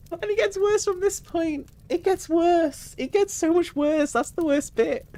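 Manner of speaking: very high squeaky voice after laughing; helpless high squeak; high-pitched squeal. Real, not acted. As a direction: squeak it out, voice shot right up. Laughing, warns story gets worse.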